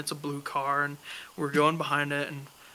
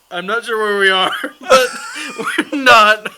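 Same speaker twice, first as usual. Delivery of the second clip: loud, higher, laughing confession; laughing through the words; 5 semitones higher, 13 dB louder, slower than usual. Real, not acted. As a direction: blurt it out while cracking up. Laughing while admitting the mistake.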